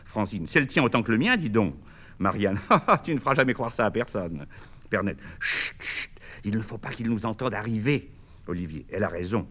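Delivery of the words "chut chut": breathy whispered shushing, no voice at all; whispered hush. Acted. Bernette hushing the others before waking Bernard, whispered. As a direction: hush them like you're sneaking upstairs.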